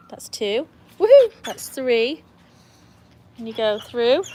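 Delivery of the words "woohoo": high, loud woohoo; excited cheer. Real, not acted. Cheering the dog on through tricks, excited cheer. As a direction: whoop it out sharply.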